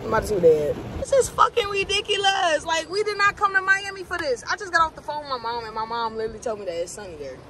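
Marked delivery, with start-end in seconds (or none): 1.0-5.4 high-pitched, indignant venting; real fed-up complaint; exasperated. Real, not acted. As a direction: protest it, voice pitched up in frustration. Venting about rain ruining vacation.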